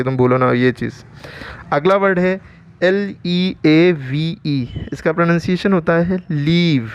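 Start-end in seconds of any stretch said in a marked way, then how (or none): 2.8-4.9 slow letter-by-letter spelling. Shown as a demonstration. Teacher spells a new word for learners, slow spelling. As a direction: spell it slowly, each letter held apart.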